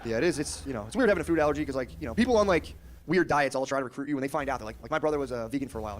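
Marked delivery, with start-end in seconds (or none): none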